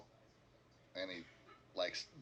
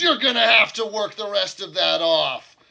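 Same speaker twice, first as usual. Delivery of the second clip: much louder, higher gruff order; innkeeper voice; 9 semitones higher, 17 dB louder than usual. Acted. Innkeeper ordering the party to work off the debt, loud. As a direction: bark the terms at them. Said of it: "He says".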